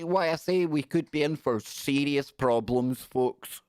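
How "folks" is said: ordinary talk, nothing marked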